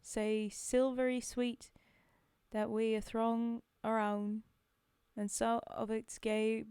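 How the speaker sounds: put-on loud Scottish-accented verse reading; lilting Scottish accent, projected. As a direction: recite it out strongly in a Scottish accent. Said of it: "it's Scottish".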